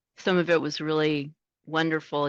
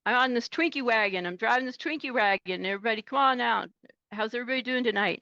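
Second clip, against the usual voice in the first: higher, fast chirpy radio patter; radio banter; 7 semitones higher, faster than usual. Retold or imitated. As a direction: rattle it off like a jolly trucker. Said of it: "he would say".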